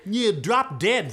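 slow, punched insolence; rude retort. Acted. Cursed sister refusing the dwarf, slow rude retort. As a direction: draw out each word rudely.

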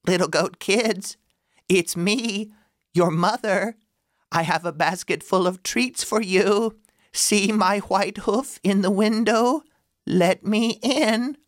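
wolf faking a high sweet mother voice; softened wolf voice. Acted. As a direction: pitch it up falsely sweet. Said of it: "clears throat".

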